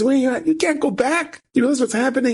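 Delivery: high-pitched, pleading disbelief; alarmed protest. Retold or imitated. Friend trying to stop his study partner going back, alarmed protest. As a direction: imitate a frantic friend, voice shooting up.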